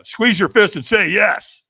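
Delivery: loud, slow coaching order; coach's command. Retold or imitated. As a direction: push him to commit. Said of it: "the trainer goes".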